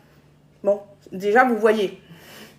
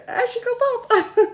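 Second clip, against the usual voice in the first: delighted; 9 semitones higher than usual. Real, not acted. Friends arriving on TikTok.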